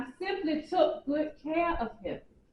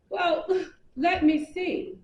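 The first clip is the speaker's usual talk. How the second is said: ordinary talk, nothing marked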